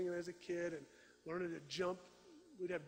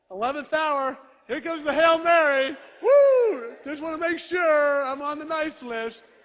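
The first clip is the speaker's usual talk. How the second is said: loud, high hammed-up cheer of a last-minute plea; mock shout; 9 semitones higher, 21 dB louder, slower than usual. Preacher mocking a child's late rush to be good, loud. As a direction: shout it out like a desperate last-ditch prayer.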